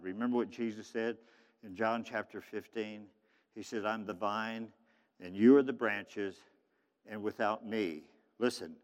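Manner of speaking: low, soft, earnest teaching voice; hushed preaching. Acted. Preacher quoting Jesus to the congregation, hushed and low. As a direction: drop low and quiet to draw listeners in.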